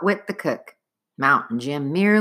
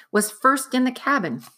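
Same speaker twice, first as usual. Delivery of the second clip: ordinary delivery, nothing marked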